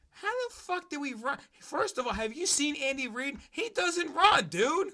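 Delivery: outraged rant. Real, not acted. Mocking the pundit on air in a high outraged voice. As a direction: voice jumps high, incredulous. Ranting at pundit over Andy Reid.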